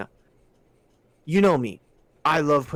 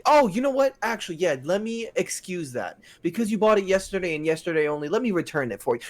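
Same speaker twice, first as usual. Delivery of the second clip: high, mock-helpful clerk; sarcastic accommodation; 6 semitones higher than usual. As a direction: pretend to give in, sweetly sarcastic. Sarcastic pretend giving in to customer.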